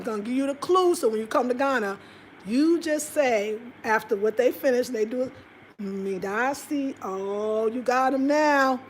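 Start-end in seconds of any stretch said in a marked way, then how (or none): 5.8-6.9 very slow, softer "Midasi". Shown as a demonstration. Vlogger teaching viewers to thank Ghanaians with Midasi. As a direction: say "Midasi" slowly with a smile. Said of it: "Make sure you smile".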